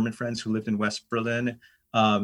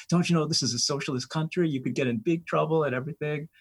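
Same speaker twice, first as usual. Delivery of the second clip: high, alarmed scolding; hint of horrified students; freaked-out warning; 5 semitones higher than usual. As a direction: act out the panicked students. Poles freaking out.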